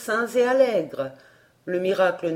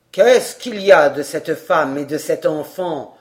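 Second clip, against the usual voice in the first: much lower, louder official demand; stern gendarme; 5 semitones lower, 6 dB louder than usual. A narrator's reading. Gendarme to the crowd, lower, louder and stern. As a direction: demand it with authority.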